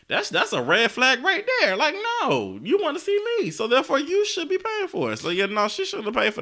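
indignant. Real, not acted. Heated opinion on the flight.

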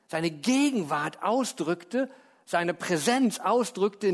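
ordinary talk

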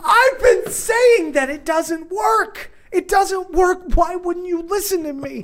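high, drawn-out mock shout; hammed-up wail of frustration; exasperated yelling. Dad retells protesting that nobody listened to him, exasperated yelling. Retold outburst at his son.

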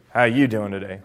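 low-pitched "How you doing today"; low-pitched greeting. Shown as a demonstration. Coach demonstrates tone of voice, low register. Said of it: "I just said the same phrase five different ways".

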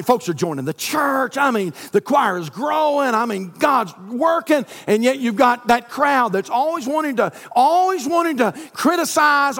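high, loud preacher's climax; fiery revival preaching; preaching at full force. Acted. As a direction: drive the point home to the congregation.

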